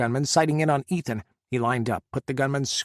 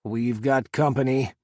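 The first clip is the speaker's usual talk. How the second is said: growled. A narrator's reading. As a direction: growl the warning under your breath. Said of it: "Scott growled".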